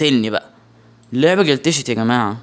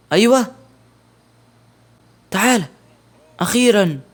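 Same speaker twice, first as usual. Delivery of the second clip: excited relief; 7 semitones higher, 3 dB louder than usual. Real, not acted. Enemy finally comes.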